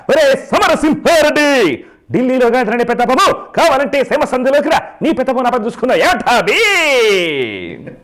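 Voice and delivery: high, loud roaring film-villain tirade; Jayaprakash Reddy, serious villain. Acted. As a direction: thunder threats with long stretched word endings. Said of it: "serious villain అయితే".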